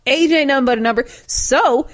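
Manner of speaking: normal talking voice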